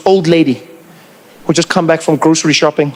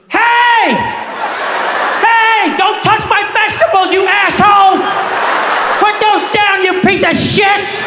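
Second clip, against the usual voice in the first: much higher screeching angry yell; furious old lady; 13 semitones higher, slower than usual. Pretend. Old lady yelling at the helper, a furious high screech. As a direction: screech at him to leave her vegetables. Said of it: "she was like".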